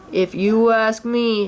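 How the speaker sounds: slow, glum donkey; gloomy Eeyore. A narrator's reading. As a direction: Eeyore gives his dreary view. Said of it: "said Eeyore".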